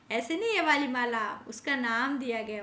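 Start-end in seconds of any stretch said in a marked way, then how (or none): 0.1-1.4 नहीं punched loud and high; stress on नहीं. Shown as a demonstration. Teacher warning the class it is not that necklace, नहीं stressed. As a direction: hit नहीं hard.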